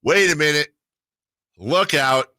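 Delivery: ordinary delivery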